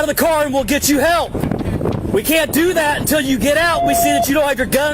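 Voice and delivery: shouting. Real, not acted. Police standoff calling out.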